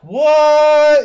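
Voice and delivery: high, loud disbelieving shout; a sudden yelp of disbelief at the tiny army; shocked what. Retold or imitated. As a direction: blurt it out in shock. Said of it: "And they're like".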